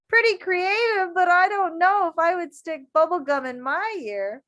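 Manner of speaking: amused. Real, not acted. Teacher amused, laughing.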